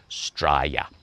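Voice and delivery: broad Australian Straya; Australian accent. Retold or imitated. Voiceover artist's all-time favorite country name, in an Australian accent. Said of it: "And my all-time favorite".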